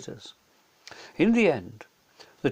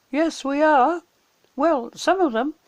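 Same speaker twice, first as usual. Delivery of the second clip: put-on much higher child voice; a bright, cheeky reply; 12 semitones higher, 6 dB louder than usual. A narrator's reading. A child answering Mr Beaver, higher and bright.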